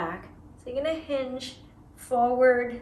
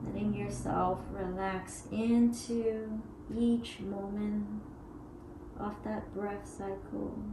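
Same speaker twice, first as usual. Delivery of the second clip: guided meditation; 7 dB quieter than usual. Acted. Yoga teacher guiding students through breath, soft meditation voice. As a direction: ease them into each pause.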